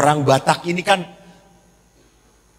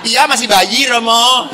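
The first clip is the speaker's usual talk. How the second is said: laughing reply; 3 semitones higher, 4 dB louder than usual. Retold or imitated. Mother laughing back.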